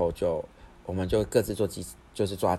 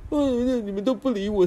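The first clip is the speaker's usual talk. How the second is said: much higher, put-on sulky younger-brother whine; mock whiny brother; 5 dB louder than usual. Host mimicking his brother complaining they ignored him, in a much higher mock whine. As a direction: whine it like a sulking kid brother.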